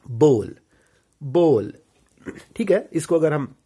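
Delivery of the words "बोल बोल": बोल low and firm with a long "o"; correct pronunciation. Shown as a demonstration. Teacher answering "क्या होता है?", correct pronunciation. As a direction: drill बोल like "bole", pausing between. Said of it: "क्या होता है?".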